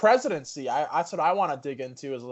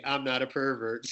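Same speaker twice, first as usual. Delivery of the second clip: mock pervert excuse; 4 dB quieter than usual. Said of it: "Which is something that perverts say".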